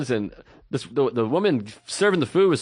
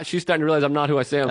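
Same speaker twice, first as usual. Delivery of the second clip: normal talking voice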